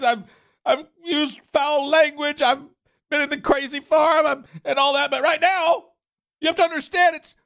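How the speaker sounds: squeaky fake-tearful parody of the official; mock crying. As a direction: ham up a blubbering imitation of her. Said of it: "Did you hear what she said?".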